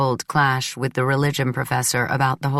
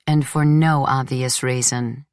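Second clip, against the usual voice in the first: ordinary delivery, nothing marked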